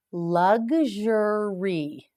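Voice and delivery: "Luxury" stretched very slowly, syllable by syllable; teacher stretching "Luxury" out; slow model word. Shown as a demonstration. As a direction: draw out "Luxury" for learners to copy.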